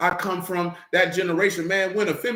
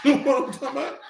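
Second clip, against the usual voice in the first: laughing; 6 semitones higher, faster than usual. Real, not acted. Laughs at own joke.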